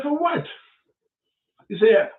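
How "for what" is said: incredulous. Retold or imitated. Repeating incredulous question.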